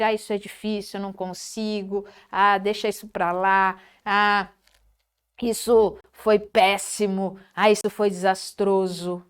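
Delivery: grave e mais baixo, sem ânimo; queixa desanimada. Shown as a demonstration. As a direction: resmungo leve de quem desiste. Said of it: "ao invés de sair". (lamentação negativa, voz caída)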